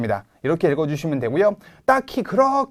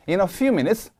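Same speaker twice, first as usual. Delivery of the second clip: few hit hard, minutes lifted at the comma; slow, punch few then lift minutes; stress on few, rise on minutes; much slower than usual. Shown as a demonstration. Teacher modeling few stressed, minutes rising. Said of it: "few 세게 읽고 minutes 콤마가 붙어 있으니까 minutes라고 들어 올려주시면".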